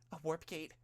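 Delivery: startled panic at the gate; panicked. A narrator's reading. Hero realizing the trap behind her, panicked. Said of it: "There was panic in the hero's voice".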